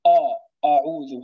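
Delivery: أعوذ with a thick, dark hamza, the wrong way; heavy hamza. Shown as a demonstration. Teacher shows the wrong heavy أعوذ. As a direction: demo the mistake, fattened hamza. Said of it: "هذا خطأ".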